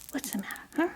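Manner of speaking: ordinary talk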